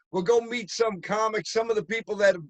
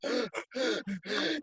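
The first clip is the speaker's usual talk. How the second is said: mock gasp for air; 4 semitones higher, 9 dB quieter than usual. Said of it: "I was like".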